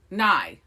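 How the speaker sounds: "Nye" rhyming with eye; said as spelled. Shown as a demonstration. Host reading the spelling first, "Nye" rhyming with eye. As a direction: read "Nye" just as written. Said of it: "I'm gonna say it the way it's spelled".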